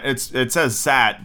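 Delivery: usual speaking voice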